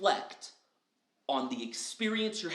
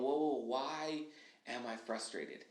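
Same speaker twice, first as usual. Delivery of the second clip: a slow self-check that fades much softer toward the end; 2 semitones lower, 7 dB quieter, slower than usual. Shown as a demonstration. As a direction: ease off and ask yourself gently. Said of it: "we're slowing down and we're going".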